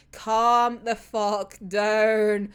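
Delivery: slow scolding. Acted. Speaker scolds Chuya.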